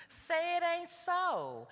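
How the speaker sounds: high, drawn-out cry of disbelief; acted disbelief. Speaker acts out the first stage for the group, in exaggerated disbelief. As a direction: stretch it out in high, theatrical dismay.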